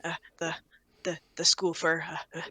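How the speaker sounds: soft, stammering shy mumble; nervous mumble. Acted. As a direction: shrink away, barely audible. Kevin nervous, shrinking.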